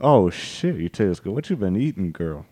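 low, soft sleazy purr; cat voice. Pretend. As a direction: croon it like a smitten cat. Voicing the cat at shoe.

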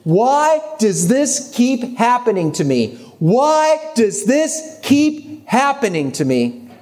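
high, slow, drawn-out lament; imitated bawling. Crying friend pleading to him on the phone, imitated wail. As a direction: imitate a tearful plea, stretching each word further. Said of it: "just bawling and saying".

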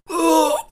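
a high sharp intake of breath; sharp gasp. Acted. Game master voices the victim's pain, sharp gasp. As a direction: gasp as if stabbed under the ribs. GM acts the stinger wound.

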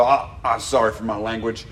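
a hint of a rough homeless man; sheepish gruff apology, lightly voiced; rough old man apologizing. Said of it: "he'd realize he was cussing and go".

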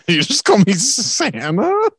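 high, slow, through laughter; laughing disbelief. Real, not acted. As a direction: laugh out the question.

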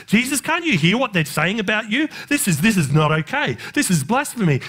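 mock-scandalised protest, pitch jumping; lightly acted outrage of a religious objector; indignant complaint. Preacher playing Pharisees complaining to Jesus, indignant.

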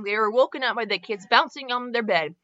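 plain reading voice

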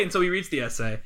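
usual speaking voice

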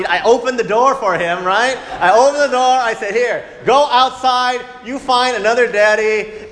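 loud, high scolding father; angry dad. Retold or imitated. Father blowing up at his rebellious son, loud angry scolding. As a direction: blow up at the kid. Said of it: "I blew up at poor guy".

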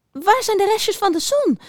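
very high squeaky mouse question; piepend. A narrator's reading. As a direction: squeak it urgently as a little mouse. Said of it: "piepte ze nog eens". (heel hoog piepend muisje)

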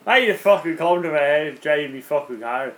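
hammed-up, shaky-authentic Irish brogue; attempted Irish accent. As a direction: play a sweary mock Irishman. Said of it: "your attempted Irish accent".

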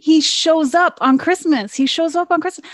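can't believe he just shows up; excited disbelief. Real, not acted. Telling friends the baffling movie ending, excited and incredulous.

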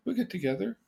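soft, high, easy acceptance; acting out a gentle, unbothered reply; reassuring husband. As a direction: let it go lightly and softly. Acting calm reply to wife.

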